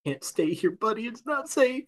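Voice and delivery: soft, tender coaxing to a pet; gentle comforting. Acted. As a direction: soothe a frightened little animal. Comforting the red panda.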